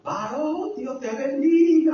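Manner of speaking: high-pitched retold exclamation; small, high mock yell; mock outcry. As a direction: imitate someone crying out, without real volume. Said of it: "salía gritando de todo".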